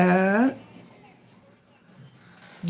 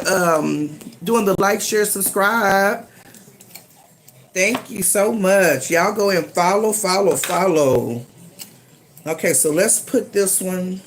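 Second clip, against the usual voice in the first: ordinary talk, nothing marked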